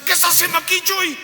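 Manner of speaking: loud, very high astonished call; sorpresa exclamada. Retold or imitated. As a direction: act amazed to find him outside, voice shooting up. El anfitrión lo encuentra afuera. (exclamación aguda de asombro)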